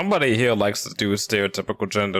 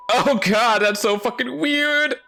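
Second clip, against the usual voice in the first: high, loud startled squeal; shocked, much higher and louder; freaked out; 5 dB louder, slower than usual. Real, not acted. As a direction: recoil at all the stares. Everyone turns to look.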